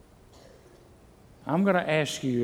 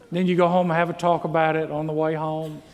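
ordinary talk, nothing marked

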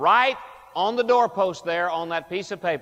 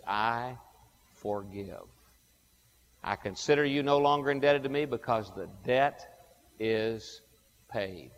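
tender pardon; 6 semitones lower, 6 dB quieter than usual. Acted. Voicing God's pardon.

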